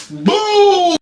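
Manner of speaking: high, long drawn-out "boom"; exaggerated high-pitched boom; punch impact noise. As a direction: voice the punch as a big sound effect. Imitates punch to the head.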